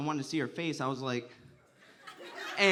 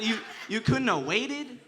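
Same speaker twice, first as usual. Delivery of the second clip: mock indignant; 6 semitones higher, 5 dB louder, slower than usual. Mock complaint to gym guy.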